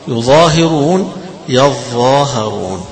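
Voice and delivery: يظاهرون slowly recited, first rising then falling; modelling يظاهرون twice for students; reading demo. Shown as a demonstration. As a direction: drawl each vowel in يظاهرون. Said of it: "ثلاث قراءات فيها".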